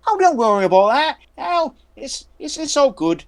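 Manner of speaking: put-on high childlike voice, eager to play; a strange boy inviting you to play war; creepy little boy. Boy dismisses screams downstairs.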